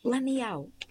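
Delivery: panicked shout. Acted. Locked in closet, screaming.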